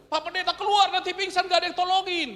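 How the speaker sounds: doctor tells the pastor to get out, high and loud; doctor's order. Retold or imitated. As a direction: shoo the pastor out like a worried doctor. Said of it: "Dokternya bilang".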